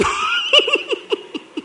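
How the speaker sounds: high unvoiced laughter; breathy laugh. Real, not acted.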